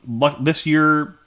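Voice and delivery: normal talking voice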